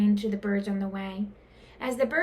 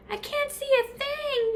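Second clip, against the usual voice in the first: very high panicked bird cry; shrill-pitched panic; bird yell. A narrator's reading. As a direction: yell in the dark. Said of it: "yelled Pip".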